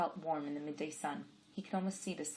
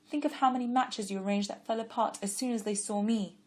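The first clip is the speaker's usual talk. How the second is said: a hint of a higher girl's voice, earnest; lightly voiced young woman; 5 semitones higher, 7 dB louder than usual. A narrator's reading. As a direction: defend him gently, then doubt herself. Girl's lines in story.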